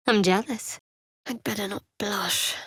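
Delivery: shy hushed whisper; half to yourself, embarrassed; flustered whisper. Acted.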